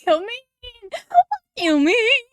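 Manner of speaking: high wailing cry; mock wail. Real, not acted. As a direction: whimper it out in despair. Distressed at character's fate.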